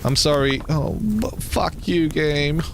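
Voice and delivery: usual speaking voice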